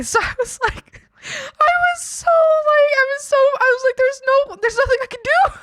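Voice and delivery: very high, loud laugh-talk; real helpless laughter; laughing hard. Real, not acted. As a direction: squeal it out through giggles. Laughing at being stranded.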